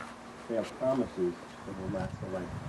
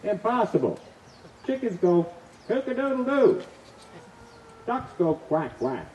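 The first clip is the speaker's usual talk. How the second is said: higher and louder, getting irritated; first irritation; 10 semitones higher, 6 dB louder than usual. Acted. Husband explains birds to wife, irritated and loud. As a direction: lecture her with rising impatience. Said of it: "with the first signs of irritation".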